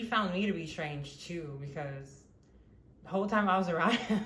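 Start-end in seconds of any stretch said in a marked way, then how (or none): 3.0-4.2 quick, louder words broken by a laugh; giggling embarrassed aside. Real, not acted. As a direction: laugh through it as you rush the line. Laughing while recalling awkwardness.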